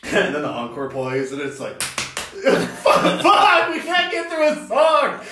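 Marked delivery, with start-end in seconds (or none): 1.8-5.2 high laughing complaint; laughing mock frustration. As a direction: moan it through laughter. Band frustrated, songs interrupted.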